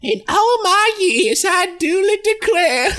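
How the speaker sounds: high, drawled Southern belle voice; elderly Southern belle. Retold or imitated. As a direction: play an old Southern belle. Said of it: "She's a, an elderly Southern belle".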